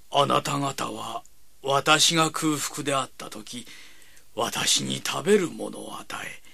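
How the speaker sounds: slow, reverent reading aloud; reading scripture. Acted. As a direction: read each verse slowly.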